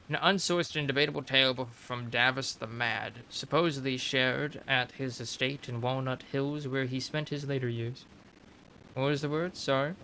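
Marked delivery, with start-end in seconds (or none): 0.1-8.0 measured storyteller read of a codex entry; lore narration. Acted. Reading a game codex entry to viewers, storyteller narration. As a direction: narrate it like a history tome.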